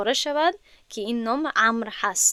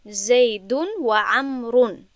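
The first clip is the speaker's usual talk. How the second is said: slow vowelled reading; slower than usual. Shown as a demonstration.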